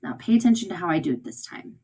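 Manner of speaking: ordinary talk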